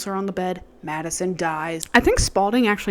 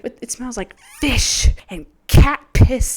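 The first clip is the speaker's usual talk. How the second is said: louder, higher, building into a slow shrieked "Naughty pie"; hammed-up horror, laughing as it climbs; Fiona's disgusted rant; 9 semitones higher, 8 dB louder than usual. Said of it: "She's like".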